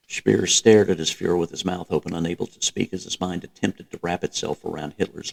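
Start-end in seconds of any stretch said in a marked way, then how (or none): none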